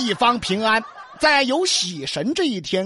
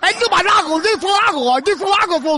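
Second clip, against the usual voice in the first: angry; 6 semitones higher, faster than usual. Acted. Angry tongue-tied official.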